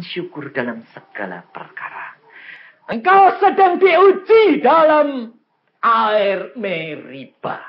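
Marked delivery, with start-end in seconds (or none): 2.9-7.6 loud, slow, drawn-out proclamation; full-force preaching. Real, not acted. Preacher telling congregation they are tested, full-force preaching. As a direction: proclaim it slowly at full volume.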